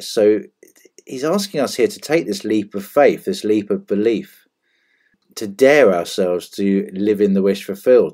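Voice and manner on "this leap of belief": normal talking voice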